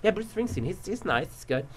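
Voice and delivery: soft, offhand and underwhelmed; unimpressed girlfriend. Retold or imitated. Girlfriend casually rating the star, unimpressed. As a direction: play it down softly, shrugging off the big name. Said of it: "she's, uh, she's like".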